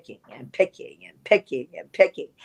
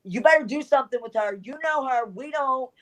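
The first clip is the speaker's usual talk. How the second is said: urgent insistence; 9 semitones higher than usual. Retold or imitated. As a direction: urge her to step in now, rushed and pushy. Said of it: "Judy's like".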